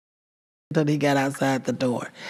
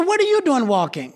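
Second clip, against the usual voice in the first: woman's voice; 9 semitones higher than usual. Retold or imitated. Voicing the woman.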